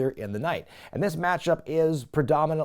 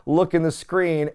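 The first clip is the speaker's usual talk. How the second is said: usual speaking voice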